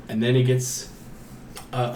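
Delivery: ordinary talk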